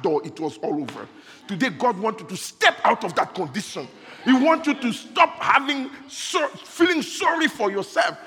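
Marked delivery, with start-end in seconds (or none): 1.5-8.2 louder and higher, pressing the point; rising preaching. Acted. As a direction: build the sermon toward a cry. Preacher rising again to a climax.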